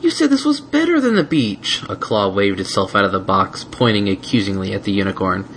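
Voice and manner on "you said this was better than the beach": high, indignant little dragon; accusing. Acted. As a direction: call out the betrayal. Spike accusing.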